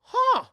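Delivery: high, loud mock gasp; startled gasp. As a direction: gasp in exaggerated shock. Girl startled by dropped remote.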